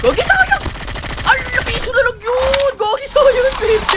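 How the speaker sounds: high-pitched cry of frustration; excited yelp. Real, not acted. Gamer crying out at a missed shot, high yelp. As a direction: squeal at the near miss.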